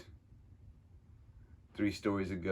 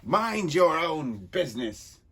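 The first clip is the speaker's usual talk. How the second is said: menacing villain warning; 7 semitones higher, 8 dB louder, slower than usual. Acted. Villain warns nosy ally.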